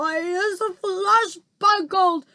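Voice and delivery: high, loud, slow roar of a monster; roaring giant. A narrator's reading. Trapped giant roars about being tied up. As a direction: bellow it out like a trapped brute. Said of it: "roared the flesh lump eater".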